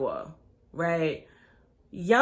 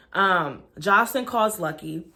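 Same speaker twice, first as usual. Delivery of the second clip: ordinary delivery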